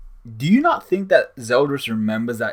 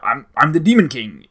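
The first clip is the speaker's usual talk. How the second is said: louder, puffed-up boast as the Demon King; mock boastful Demon King; 3 semitones higher, 8 dB louder than usual. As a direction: ham up the villain's empty bragging. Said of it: "the Demon King's speech".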